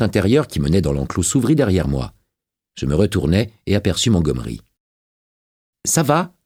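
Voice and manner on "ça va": lightly voiced, high-pitched quick question; Montgomery's brisk greeting. A narrator's reading. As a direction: toss out a high, passing greeting.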